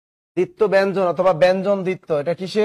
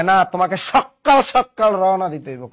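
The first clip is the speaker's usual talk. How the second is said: both সকাল said sok-kal with a held k; insist, punching the k in সকাল; double pressure. Acted. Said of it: "double pressure পড়বে".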